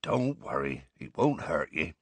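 low, soft reassurance; quiet, patient gamekeeper. A narrator's reading. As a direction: soothe a frightened pupil gently. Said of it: "said Hagrid patiently".

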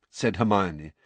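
ordinary delivery

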